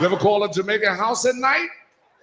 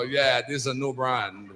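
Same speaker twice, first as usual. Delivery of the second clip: mock Jamaican friend; 6 semitones lower, 3 dB quieter than usual.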